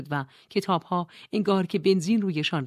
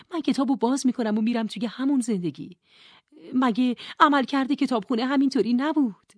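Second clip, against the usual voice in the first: put-on higher, insistent young woman; Nora questioning; 5 semitones higher, 3 dB louder than usual. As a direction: push back, sure of the rules. Nora argues with Mrs Elm.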